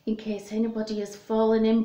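ordinary delivery, nothing marked

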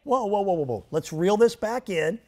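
ordinary delivery

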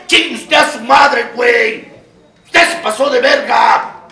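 slow, loud, furious cursing; angry shout. Acted. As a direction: spit each word out at him. Cursing an imagined listener.

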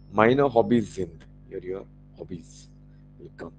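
ordinary delivery

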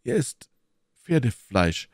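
"Pferdefleisch" stated soberly with a falling end; pause, then reveal it in earnest; serious, measured verdict; grave announcement. A narrator's reading. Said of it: "entgegnete Athos ernst".

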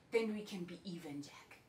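soft, fading to a faint whisper; quiet whispered ending; hushed vengeful resolve. Acted. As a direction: let the last words sink away. Monologue ends on whispered revenge.

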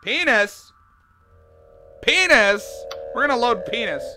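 ordinary delivery

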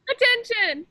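put-on calling out commands to a room of kids; game caller. Leader running Captain's Orders game with kids, called out.

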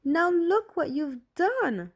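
put-on a furious girl blaming her sister; yelling. A narrator's reading. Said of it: "Julie yelled".

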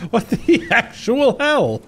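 slow, high, stretched out; drawn-out disbelief. Real, not acted. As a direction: stretch every word in outrage. NPC headbutts him.

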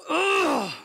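a loud, high guttural "Ugh!"; guttural groan. Shown as a demonstration. Preacher voicing Jesus outraged at the tomb, guttural groan. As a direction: groan from the gut, outraged at death. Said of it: "there's a guttural element to that word".

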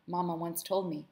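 ordinary delivery, nothing marked

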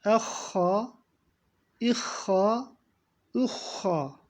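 Ehha with a rasping kh from the throat; throat-clearing kh. Shown as a demonstration. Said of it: "Boğazı temizleme hareketi bu".

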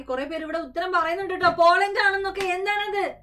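high, loud disbelieving exclaim; host shocked at wrong guesses; surprised outcry. Real, not acted. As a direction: react loudly to a silly answer. Host reacts to wrong answers.

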